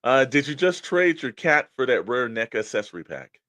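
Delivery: hammed-up commercial question; playful fake-ad line; mock infomercial read. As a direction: ask it like a cheesy ad pitch.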